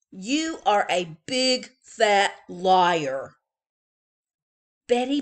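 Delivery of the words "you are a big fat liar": slow, loud, voiced-apart accusation; big brother accusing. A narrator's reading. Big brother to his little sister, slow and loud. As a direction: drag out each word of the insult.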